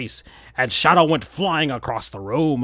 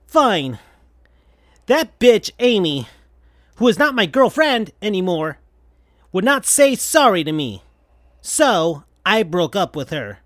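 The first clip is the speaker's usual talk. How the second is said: frustrated rant; 5 semitones higher than usual. Acted. Sonic venting to Tails about Amy, bitter. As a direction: spit out the grievance. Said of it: "Sonic looked mad and frustrated".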